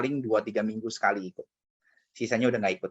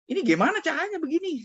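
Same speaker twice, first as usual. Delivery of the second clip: much higher, put-on plaintive voice; indignant question. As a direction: whine the question at mom. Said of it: "saya bilang".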